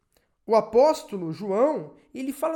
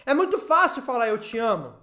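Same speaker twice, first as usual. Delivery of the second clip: forceful, raised sermon delivery; impassioned preaching; 3 semitones higher, 4 dB louder than usual. Real, not acted. Preacher laments divided believing families, impassioned preaching. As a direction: press each clause home to the congregation.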